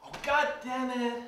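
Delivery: high-pitched acted curse; frustrated outburst; exasperated curse. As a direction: swear in alarm at the controls. Pilot reacts as scene goes wrong.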